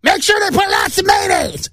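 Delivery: high, slow, strained shout of a demanding woman; shrill shrieking customer yelling for mayo; screaming lady. Retold or imitated. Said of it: "That's exactly how her voice sounded".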